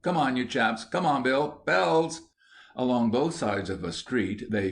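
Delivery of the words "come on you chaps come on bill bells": higher-pitched call to the gang. A narrator's reading. Gang leader rallying his chaps, calling out. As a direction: call the lads on, voice lifted. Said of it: "called out".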